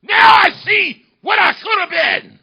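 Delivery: high, slow, full-voiced cry; impassioned shout. Orator repeating his father's words to the crowd, shouted. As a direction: hurl it out to the whole hall.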